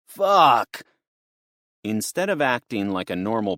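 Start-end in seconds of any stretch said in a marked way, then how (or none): 0.1-0.8 loud high scream of pain. Acted. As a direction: scream out after smashing himself. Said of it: "The guy screamed in apparent agony".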